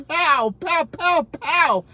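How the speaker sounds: loud, high mock pow pow; gunshot noises. Imitating Yosemite Sam's guns, loud mock pow. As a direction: shoot like a cartoon cowboy.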